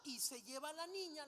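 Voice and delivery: ordinary delivery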